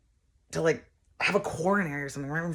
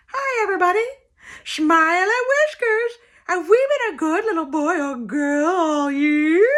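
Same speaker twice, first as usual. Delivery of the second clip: over-the-top cutesy rabbit voice; bunny hand puppet; 11 semitones higher, 7 dB louder than usual. Acted. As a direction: squeal it like a kids' puppet bunny. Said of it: "had a hand puppet".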